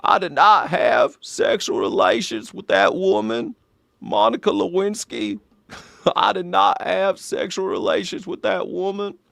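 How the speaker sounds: Bill Clinton impression. Comedian doing Bill Clinton as an old Walmart greeter. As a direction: deliver it like an aging Clinton greeter. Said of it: "my Bill Clinton impersonation is just an old guy".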